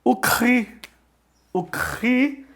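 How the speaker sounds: cri said high with a throaty ch; Greek letter chi. Shown as a demonstration. Teacher naming the Greek letter chi with a throaty sound. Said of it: "ele se translitera por C H".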